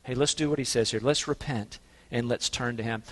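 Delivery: ordinary delivery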